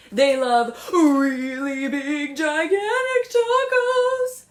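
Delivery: singsong giant voice for big tacos; really big. Shown as a demonstration. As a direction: make it sound huge. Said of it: "Can you show me really big".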